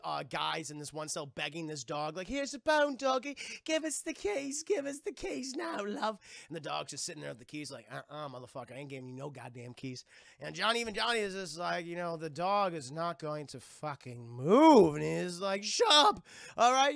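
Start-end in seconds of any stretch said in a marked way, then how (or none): 2.3-6.2 high, sweet-talking coax; higher, wheedling a dog for keys; coaxing prisoner. Retold or imitated. Prisoners coaxing the jail dog, high and sweet-talking. 7.9-10.1 low, quiet, unimpressed refusal; sassy dog voice. Retold or imitated. The dog refusing the prisoners, low and dismissive. 15.6-16.9 higher, loud snapping shout; angry shout. Retold or imitated. Johnny Depp yelling at the other prisoners, a loud shout.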